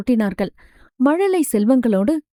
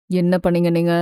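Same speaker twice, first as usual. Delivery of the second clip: gentle father; 6 semitones lower than usual. Acted. Father asks daughter gently.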